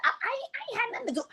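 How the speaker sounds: very high, fast, stammering put-on voice; high squeaky mock denial. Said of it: "She's like".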